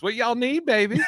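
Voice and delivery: put-on high, sweet grandma calling baby. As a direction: coo it like a doting grandmother. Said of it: "In my grandma voice".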